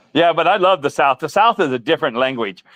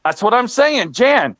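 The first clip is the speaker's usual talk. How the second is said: higher, insistent mock North Carolina drawl on "Jan"; imitating country friend; 7 semitones higher, much slower than usual. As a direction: play the frustrated North Carolina boy saying "Jan" for John. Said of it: "He goes".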